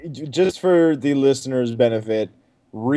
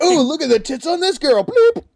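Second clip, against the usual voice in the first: mocking porn sharer; 13 semitones higher, 3 dB louder than usual. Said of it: "It's like".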